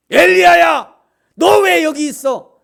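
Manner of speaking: high, very loud scolding shout; full-force shout, drawn out at the end; booming yell. Shown as a demonstration. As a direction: bellow it as a furious summons. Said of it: "그렇게 얘기한 게 아니라".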